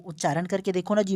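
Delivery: usual speaking voice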